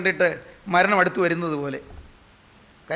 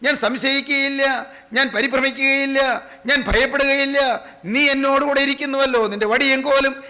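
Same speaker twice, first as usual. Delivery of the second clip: raised, sustained preaching cadence; declaimed prayer; 7 semitones higher, 4 dB louder than usual. Acted. Preacher declaring to the Lord he will not fear, declaimed prayer. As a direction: affirm each line with steady force.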